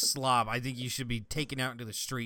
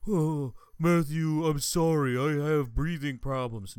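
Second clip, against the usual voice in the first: slow, loud, whining excuse; whiny stepdad; 4 dB louder, slower than usual. Retold or imitated. Retelling the stepdad at breakfast, mocking whine. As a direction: mimic the stepdad's pathetic whine. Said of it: "You eat like a fucking dipshit".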